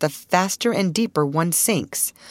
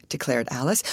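usual speaking voice

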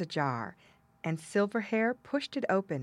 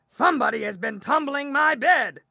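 loud, higher-pitched bear growl; growling big bear; 8 semitones higher, 9 dB louder than usual. A narrator's reading. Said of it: "the big bear growled".

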